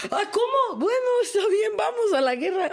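high, laughing half-shocked resolve; mock shocked reaction. Telling the host how she took the diagnosis, with mock surprise. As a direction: act out resigned surprise, then rally.

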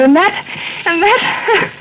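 breaks into a high giggle; high nervous giggle mid-confession; nervous laugh. Acted. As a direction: giggle as if caught out. Wife laughs recalling murder.

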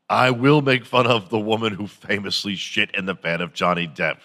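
ordinary talk

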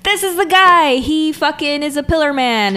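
loud, high imitation of frantic warning; shouted warning. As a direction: call out the alarm as Speedwagon. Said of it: "he's, like, warning him".

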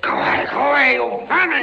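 high, loud shout at the rats; shooing shout. Acted. Prisoner yelling at the rats by his plate, high and loud. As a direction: drive the vermin off.

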